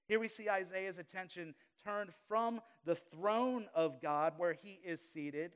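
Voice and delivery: usual speaking voice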